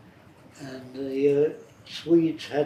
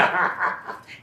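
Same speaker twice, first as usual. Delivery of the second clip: laughing; 3 semitones higher than usual. Real, not acted. Laughing at his imitation.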